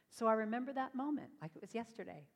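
ordinary talk, nothing marked

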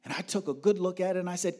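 ordinary talk, nothing marked

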